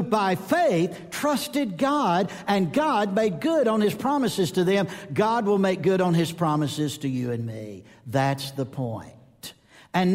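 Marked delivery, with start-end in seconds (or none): none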